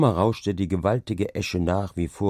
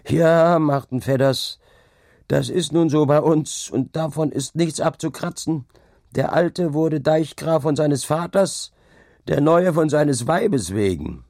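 high, raised voice to the whole table; tavern declaiming; 6 semitones higher, 4 dB louder than usual. A narrator's reading. Drinker delivering his quip to the laughing table, declaiming. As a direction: proclaim the jibe loudly, pitched up.

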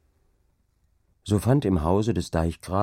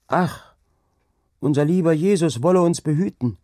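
high, louder breathless alarm; frightened exclamation; 10 semitones higher, 6 dB louder than usual. Acted. Angrete crying out to the maids, high and frightened. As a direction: cry out in superstitious fright. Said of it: "rief Angrete".

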